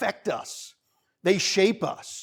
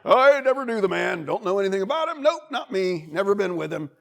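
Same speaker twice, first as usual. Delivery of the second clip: higher, emphatic mock denial; lying Peter; 4 semitones higher, 4 dB louder than usual. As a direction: blurt a hammed-up denial, easing off toward the end. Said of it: "Peter bolted and lied".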